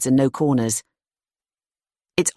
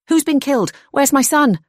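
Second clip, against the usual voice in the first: high, loud, desperate demand for her son; frantic shout; 12 semitones higher, 5 dB louder than usual. Acted. Frantic mother to the frightened girl, loud and desperate. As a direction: cry out in panic for the missing boy.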